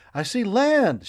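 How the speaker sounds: high, loud triumphant shout; excited shout. A narrator's reading. Boy tells his father he sees land, loud high shout. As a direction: shout the news out. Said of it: "shouted Love".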